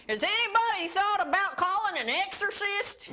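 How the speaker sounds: put-on comic Southern accent pitched high. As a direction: ham up a Southern drunk uncle. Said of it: "I gotta do this in a Southern accent".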